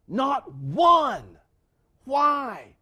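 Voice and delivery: loud, ringing declaration on one; preaching shout. Real, not acted. Preacher to congregation, no one recanted, shouted. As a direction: hammer the word one home. Preacher driving the point home.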